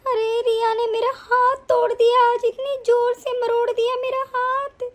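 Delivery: wailing, sobbing complaint; crying. Acted. Mother complaining to her son about her twisted hand, crying. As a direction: sob pitifully through the words. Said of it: "आप यहाँ बैठ के क्यों रो रही हैं".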